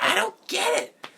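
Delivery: high, loud mock outburst; Nostalgia Critic. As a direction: screech it in exaggerated bafflement. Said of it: "Nostalgia Critic impression".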